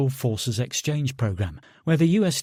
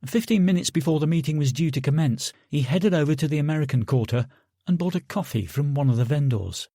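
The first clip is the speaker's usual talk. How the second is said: ordinary delivery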